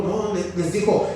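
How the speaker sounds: ordinary delivery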